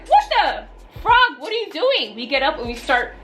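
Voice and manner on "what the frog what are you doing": high-pitched, incredulous retold outcry; startled outcry. Drunk friends spot him leaving, high startled outcry. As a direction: replay a baffled, high-voiced reaction.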